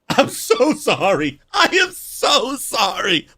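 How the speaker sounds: building to a high, loud, slow plea; frantic apology. Real, not acted. Streamer to viewers after loud sound effects, frantic apology.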